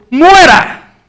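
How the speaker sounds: a high shouted cry of the crowd; mob shouting. Retold or imitated. Preacher voicing the crowd calling for Paul's death, shouted. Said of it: "la multitud del pueblo gritaba".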